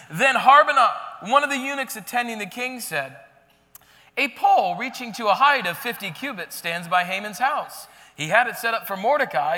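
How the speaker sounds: loud, emphatic reading of the passage; scripture reading. Acted. Preacher reading Haman's end to the congregation, loud scripture reading. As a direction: read it out like the climax.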